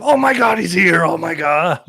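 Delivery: loud, high shout of disbelief; thrilled yell, starting very high and easing down; excited fan outburst. Retold or imitated. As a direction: re-enact losing it as your hero arrives. Retells his own excited reaction.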